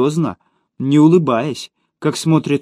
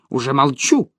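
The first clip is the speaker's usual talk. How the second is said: plain reading voice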